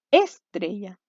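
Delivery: "estrella" said ÉS-tre-lla, first syllable hit; stress on first syllable. Shown as a demonstration. Teacher testing which syllable sounds right, "estrella" stressed on ES. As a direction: wrongly punch the ES of "estrella". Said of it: "vamos a ver dónde golpea más fuerte el sonido".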